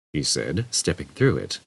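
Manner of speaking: plain reading voice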